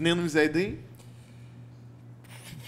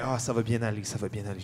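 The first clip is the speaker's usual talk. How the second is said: soothing; 7 semitones lower, 6 dB quieter, faster than usual. Acted. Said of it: "puis je fais".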